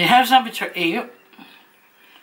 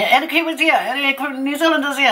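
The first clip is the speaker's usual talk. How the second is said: higher-pitched crowd call; imitating shouting; 7 semitones higher than usual. Entertainer calling on the New Zealanders, mock shouting. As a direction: mimic a showman calling out, lightly. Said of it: "he starts shouting out".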